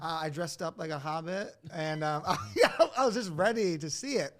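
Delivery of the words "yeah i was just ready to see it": laughing. Real, not acted. Laughing at his hobbit costume.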